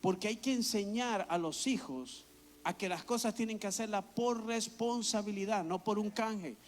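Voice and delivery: usual speaking voice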